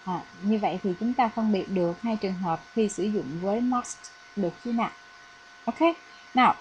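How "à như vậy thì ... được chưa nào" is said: normal talking voice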